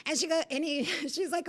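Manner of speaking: ordinary talk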